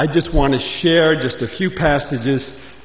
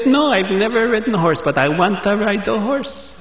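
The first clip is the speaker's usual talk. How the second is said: put-on higher, eager young man's voice; eager Moshe; 6 semitones higher than usual. Moshe pleads with the corral keeper, higher and eager. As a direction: play the keen city boy who's never ridden.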